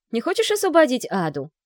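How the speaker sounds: loud, high call after; storming off shouting. A narrator's reading. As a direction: shout it after someone leaving. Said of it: "крикнула вслед Вика".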